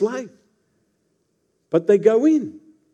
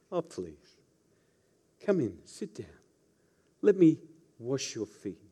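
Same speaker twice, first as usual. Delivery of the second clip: sugary fake welcome, much softer; insincere smile; 6 semitones lower, 8 dB quieter than usual. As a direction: smile falsely while inviting them in, quietly. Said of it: "Pappy calls that the insincere smile".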